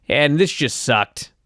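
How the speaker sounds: ordinary talk, nothing marked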